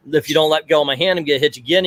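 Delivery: normal talking voice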